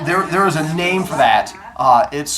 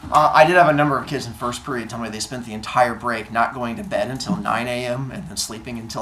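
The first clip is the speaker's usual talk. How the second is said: ordinary delivery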